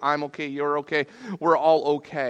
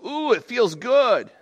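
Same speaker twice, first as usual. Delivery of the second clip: louder, higher, drawn-out pleased reaction; mock delight; 8 semitones higher, 6 dB louder, slower than usual. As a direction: savour it with a slow, lifted ooh.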